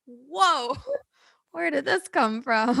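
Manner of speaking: high, startled "whoa" in disbelief; surprised. Retold or imitated. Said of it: "I was like".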